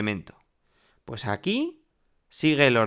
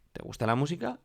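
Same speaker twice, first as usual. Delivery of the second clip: "¿Te gusta la música?" softly asked, climbing at the end; rising question intonation; 4 semitones higher, 5 dB quieter, faster than usual. Shown as a demonstration. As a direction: raise "música" high to ask. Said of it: "En español hacemos igual". (pregunta suave que sube en "música")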